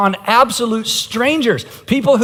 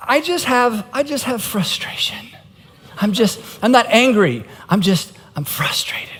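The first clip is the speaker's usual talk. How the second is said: mild self-justifying denial; 3 semitones higher than usual. Acted. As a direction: play someone politely denying their anger. Acting out denial of anger.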